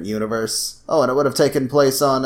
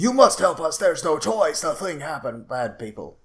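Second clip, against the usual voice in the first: higher, stiff badly dubbed movie voice; bad dubbing; 5 semitones higher than usual. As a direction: read it like a clunky English dub of a monster movie. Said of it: "those badly dubbed Godzilla movies".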